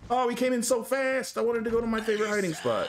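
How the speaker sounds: very high, rushed wail of dismay; panicked whine. Real, not acted. Player surprised as killer came in so fast, panicked whine. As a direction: whine it high, speeding up as the plan falls apart. Killer bursts in too soon.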